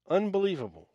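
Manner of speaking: ordinary talk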